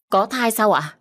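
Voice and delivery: surprised together. Acted. Husband and maid hear of the pregnancy, astonished. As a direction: gasp out the news in disbelief. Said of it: "đồng thanh".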